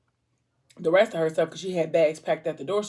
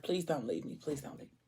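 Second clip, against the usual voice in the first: softer and softer begging; fading plea; 3 semitones lower, 14 dB quieter than usual. Retold or imitated. Boyfriend begging as she cries, fading to nothing. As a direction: let the plea die away.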